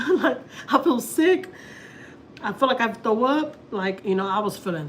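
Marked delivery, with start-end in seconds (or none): 0.0-3.6 high, loud weepy whine; retold tearful complaint, pitched up; crying to husband. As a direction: whine it out through imitated sobs. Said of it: "I started crying to my husband".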